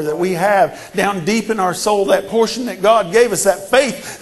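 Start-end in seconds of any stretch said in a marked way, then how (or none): none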